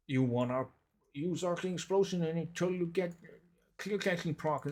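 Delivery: high, mocking know-it-all recital; mocking guide voice. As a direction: parody a fussy guide explaining. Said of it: "ultra complex".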